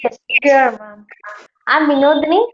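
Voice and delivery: ordinary delivery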